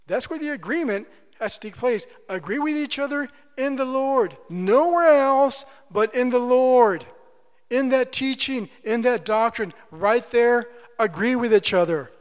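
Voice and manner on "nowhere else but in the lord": "Nowhere" hit hard, slow and loud; emphatic preaching. Real, not acted. Pastor insisting to the church, slow and loud. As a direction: drive it home, word by word. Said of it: "preaching emphasis".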